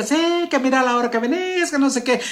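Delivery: high scolding mock shout; shrill-free mock scold, pitched high; mock nagging wife. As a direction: play the wife scolding her late husband. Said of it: "la esposa le grita".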